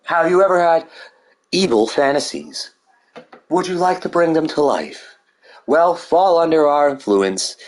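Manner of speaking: a hint of a cult recruiter luring listeners; mock villain pitch. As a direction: tempt them like a cheesy evil commercial. Mock sinister sales pitch.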